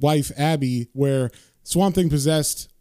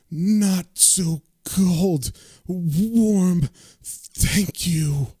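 very slow, higher ghostly moan; Jocko; 3 semitones higher, much slower than usual. Retold or imitated. Ghost thanking John for the hug, slow ghostly moan. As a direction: stretch each word like a fading ghost. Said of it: "the ghost of Jocko says back to him".

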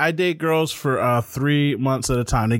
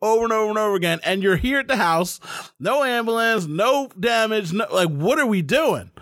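raised, firm talking-to; a hint of a firm partner calming a crying girlfriend; lightly voiced firm reassurance; 7 semitones higher than usual. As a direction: snap her out of it, firmly. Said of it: "I'm like".

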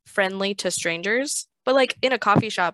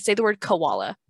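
usual speaking voice

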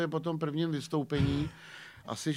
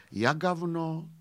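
slow, weighty recital of the line; measured Russian-flavoured poem reading; 3 semitones higher, slower than usual. Acted. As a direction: declaim it slowly like a poet. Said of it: "básní".

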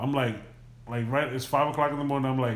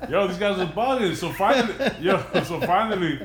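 laughing; 4 semitones higher than usual. Real, not acted. Laughing while retelling.

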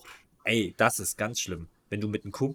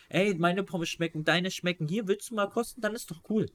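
higher, friendly offering voice; 7 semitones higher than usual. Retold or imitated. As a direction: offer fries, pitched up. Acting out polite offer.

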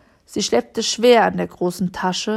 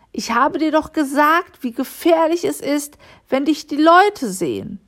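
higher-pitched, reproachful telling-off; scolding; 7 semitones higher, 4 dB louder than usual. A narrator's reading. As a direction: scold like a worried guardian. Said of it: "schimpfte Frau Lissi". (schimpfend, mit erhöhter Stimme)

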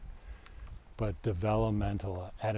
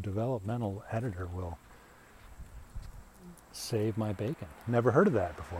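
ordinary talk

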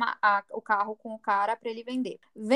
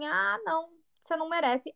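angry; 6 semitones higher, 4 dB quieter than usual. Retold or imitated. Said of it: "eu fiquei brava e falei assim".

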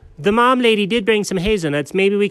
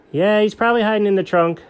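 ordinary talk, nothing marked

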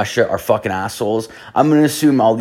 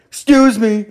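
high, loud excuse me; mock call-out; 13 semitones higher, 5 dB louder than usual. Imitating the guard calling after him, loud and high. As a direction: imitate someone shouting after you.

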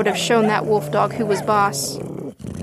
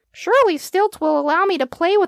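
small dog's inner thoughts; 6 semitones higher than usual. A narrator's reading. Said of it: "thought Stretch".

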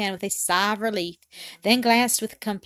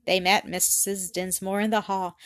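plain reading voice

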